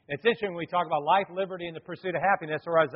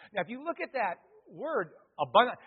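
normal talking voice

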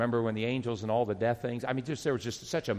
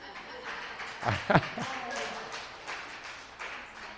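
breathy laugh; 9 semitones higher, 6 dB quieter than usual. Real, not acted. Surprised by gift.

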